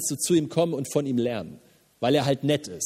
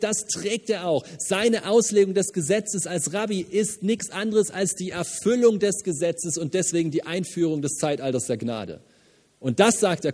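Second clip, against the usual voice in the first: ordinary delivery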